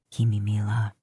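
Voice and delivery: lightly voiced old chief, grave; deep-set elder warning; low chief voice. A narrator's reading. Chief warning his niece, low and grave.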